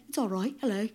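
a hint of a British accent. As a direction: say it like a polite Englishman, a light British accent.

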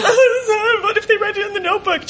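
high, rushed, laughing mock sobbing; hammed-up despair, tumbling out fast; anguished wail. Kid pleading with mom on banquet night, high mock-crying rush.

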